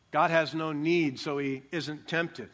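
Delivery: usual speaking voice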